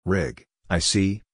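normal talking voice

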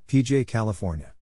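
ordinary talk, nothing marked

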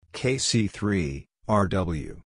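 usual speaking voice

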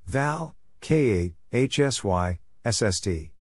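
ordinary talk, nothing marked

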